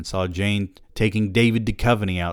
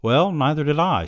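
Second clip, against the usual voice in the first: higher female voice; 9 semitones higher, 5 dB louder than usual. A narrator's reading.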